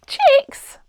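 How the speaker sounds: put-on girl cooing at fluffy chicks. A narrator's reading. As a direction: coo over the cute chicks. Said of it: "cooed Seb".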